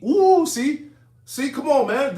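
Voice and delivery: ooh, see, he can't hold it in; excited outburst. Real, not acted.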